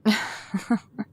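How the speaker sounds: short amused chuckle; a light laugh at her friend; brief teasing laugh. Acted. Laughs at psychic comment.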